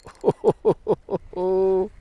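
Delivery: high, hooting "hoo-hoo-hoo" laugh; gleeful laugh. Real, not acted. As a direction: whoop with laughter, pitched high. Fish pulls hard.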